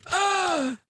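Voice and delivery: high-pitched "ah"; sudden high yelp at a tough question; startled outcry. Real, not acted. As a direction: let out a high, drawn-out cry. Reacting to being asked who wins.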